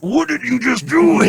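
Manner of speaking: loud, high, slow outraged dad; mock dad yell. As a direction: bellow it like a furious father. Dad glaring from office window.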